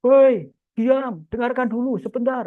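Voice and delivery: loud, high call over a crowd; acted yell to hush a noisy room. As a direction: call out sharply, demanding attention. Acted shout at a noisy audience.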